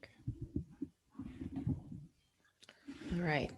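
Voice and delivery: murmured half-whisper aside while searching for a file; hushed muttering to self. Real, not acted. Teacher muttering to herself while fixing her slides, hushed. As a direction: drop to a private mumble, not for the class.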